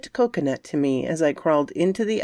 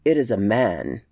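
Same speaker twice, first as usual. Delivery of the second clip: lower, heavy creature voice; low beast voice; 7 semitones lower than usual. A narrator's reading. Hidden creature announcing the newcomer, lower and heavy. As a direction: speak low like a hidden brute. Unseen beast speaks from darkness.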